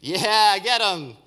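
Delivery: acted rallying cry, high and loud; crowd shout. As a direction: whoop like an approving mob. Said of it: "all the Samaritans shouted".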